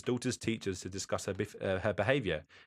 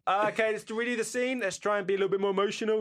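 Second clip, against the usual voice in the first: high-pitched director giving a note; acted instruction, raised in pitch and pushed; film director role; 12 semitones higher, 5 dB louder, faster than usual. Director coaching an actor before a retake, acted coaxing. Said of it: "And then he, he goes".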